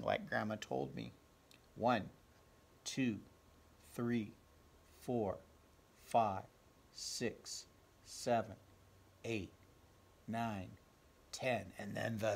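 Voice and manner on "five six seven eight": plain reading voice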